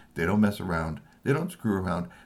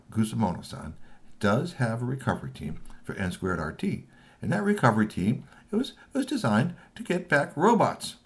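plain reading voice

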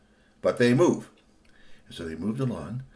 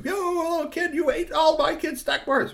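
very high, scolding mom voice; yelling mom; 3 dB louder than usual. Acted. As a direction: screech at a kid as an outraged mom. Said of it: "started yelling at you".

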